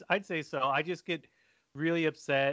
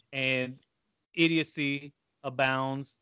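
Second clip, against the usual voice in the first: usual speaking voice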